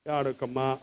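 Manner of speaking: soft, tender, near-whispered comfort to a crying woman; a preacher softly soothing a weeping listener, tender hush. Shown as a demonstration. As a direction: hush her tears gently, barely above a breath. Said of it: "అని ఇట్లా అన్నారనుకోండి".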